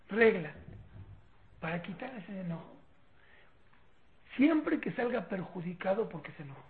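normal talking voice

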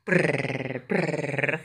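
soft rolled purr; cat purring. Retold or imitated. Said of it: "ruiditos de felicidad".

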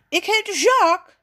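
high, loud, irritated correction; annoyed. A narrator's reading. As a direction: set them straight, exasperated. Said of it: "zegt neef geërgerd". (geërgerd, luid en hoog)

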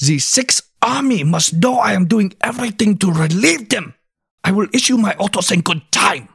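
put-on high, fired-up German accent; Hitler German accent. Host reads Hitler's reply to Paulus in a German accent. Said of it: "German accent, please".

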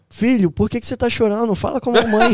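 high, loud fretting-mum voice; hammed-up worried mum; imitated mother. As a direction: mimic a mother pleading with her crying son. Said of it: "Aí minha mãe assim".

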